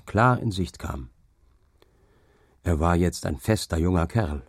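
ordinary delivery, nothing marked

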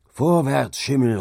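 high, loud urging of a horse; calling out. A narrator's reading. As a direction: call out a forceful command. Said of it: "rief Hauke".

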